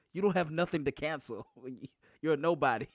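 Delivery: lady's put-down, read out through laughter; laughing retelling. Retold or imitated. Commenter mocking a guy afraid of cancel, laughing. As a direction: quote her jab while cracking up. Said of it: "one lady said".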